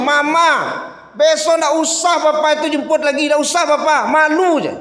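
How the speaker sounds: put-on high whiny child to mama; small child whining; lightly voiced little child. (anak TK merengek kepada mama)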